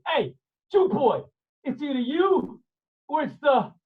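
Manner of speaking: bully him; menacing sailor. Acted. Gentile sailor threatens.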